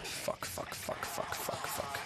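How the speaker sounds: breathless whispered swearing, barely voiced; whispered cursing. Real, not acted. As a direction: hiss the curses under your breath. Panicked whispered swearing in game.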